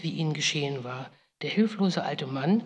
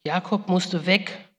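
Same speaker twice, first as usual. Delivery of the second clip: usual speaking voice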